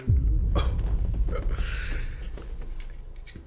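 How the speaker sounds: big loud laugh, breathy; bursting out laughing; loud burst of laughter. Real, not acted. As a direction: crack up loudly. Laughing at teacher tabs story.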